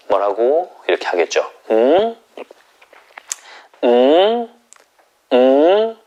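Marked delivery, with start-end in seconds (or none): 1.7-6.0 "음" rising high; second tone. Shown as a demonstration. Teacher voicing Jung Woo-sung's rising second-tone "음". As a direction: lift "음" upward like a curious gentleman. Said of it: "올리는 게 이성이에요".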